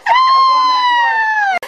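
high loud cackle; shrieking laugh. Real, not acted. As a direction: burst out laughing. Big laugh after joke.

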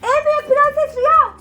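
high, loud, slow yell with a laugh; shouting. Pretend. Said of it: "Bağır, bağır".

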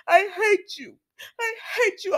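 high, crying hate-filled wail at Bill; tearful outburst. Retold or imitated. Steffi cries her hatred at Bill, high and tearful. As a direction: sob it out at the betrayer.

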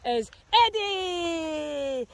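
drawn-out, slow presenter reveal; announcing. Real, not acted. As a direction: stretch the name like a showman. Announcing the dog.

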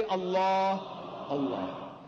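ALLAH stretched on a long dark "aah"; correct pronunciation. Shown as a demonstration. Speaker leads all of them in saying it, drawn-out ALLAH. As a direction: hold the heavy L and open ah in "Allah". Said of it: "Say Allah".

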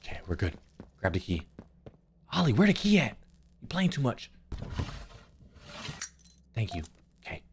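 hushed, nervous and soft; scared horror game streamer. Real, not acted.

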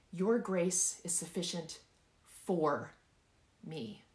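"for" isolated by pauses and hit firmly; deliberate, emphatic "for"; stress on FOR. Shown as a demonstration. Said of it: "I want you to emphasize each word".

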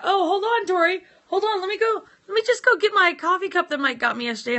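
high, loud and rushed; excited. Real, not acted. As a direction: burst out all excited. Sudden excited outburst.

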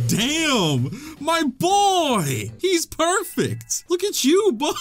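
drawn-out gushing, leaping up high; thrilled. Real, not acted. Player admiring his evolved Pokemon, thrilled. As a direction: gush with proud delight, stretching the words.

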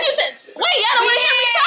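real laughing squeal; laughing outburst. Real, not acted. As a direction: burst out laughing mid-gush. Gushing about [name].

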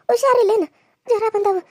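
anxious fussing; alarmed concern. Acted. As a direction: pitch it up in sudden worry for a sick child. Grandmother discovers girl has a fever.

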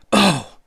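high, loud roar of rage; gasping roar. A narrator's reading. Ishtar spurned by Gilgamesh, gasping roar. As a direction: roar with a burst of breath. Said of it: "Ishtar gave a gasping roar".

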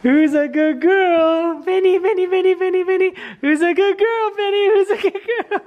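squealing pet talk. Real, not acted. Gushing baby talk to the dog.

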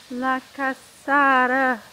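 slow, loud, savored La Cascada; excited announcement. Real, not acted. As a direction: proclaim La Cascada like a grand reveal. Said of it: "There it is".

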